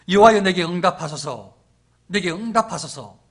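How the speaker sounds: loud, solemn petition to God; prophet's forceful public prayer; Elijah's prayer. Acted. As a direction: declaim each clause with weight, pleading for an answer. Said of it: "기도를 잘 들어보세요".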